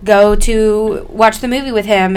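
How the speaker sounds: ordinary talk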